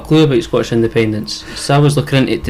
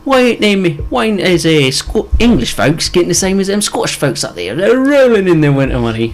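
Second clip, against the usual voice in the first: higher-pitched put-on English accent; mock English accent; 6 semitones higher than usual. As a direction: imitate indignant English folk in a bad accent. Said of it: "Is that an English accent?".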